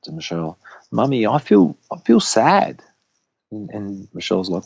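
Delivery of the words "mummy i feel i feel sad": higher-pitched imitation of a sad little boy; small child's voice, pitched up; Axel. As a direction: gently mimic a toddler telling mummy he's sad. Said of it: "Axel said to, to Michelle".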